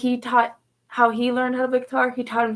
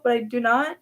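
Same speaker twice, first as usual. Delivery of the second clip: usual speaking voice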